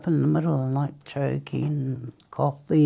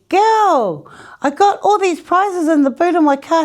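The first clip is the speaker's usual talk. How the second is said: much higher and louder, bursting with news; excited announcement; 13 semitones higher, 8 dB louder than usual. Retold or imitated. Tracy telling her about the prizes, much higher and louder.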